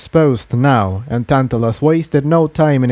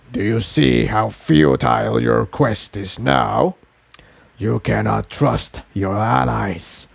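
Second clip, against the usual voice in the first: ancient being; 3 semitones lower than usual. Acted. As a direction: speak as something vast that has swallowed a soul. Tantalus tempts Jigsaw.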